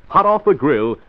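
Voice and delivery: ordinary talk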